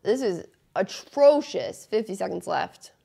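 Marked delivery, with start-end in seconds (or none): 0.0-1.9 disgusted. Real, not acted. Fuming over sloppy play.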